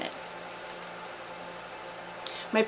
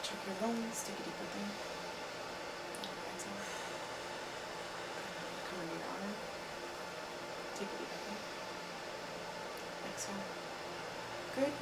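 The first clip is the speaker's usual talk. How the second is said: quiet, close, gentle prompts; soft instructions; 2 semitones higher, 17 dB quieter, faster than usual. Acted. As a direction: murmur the breathing cues softly. Listening to the lungs with a stethoscope.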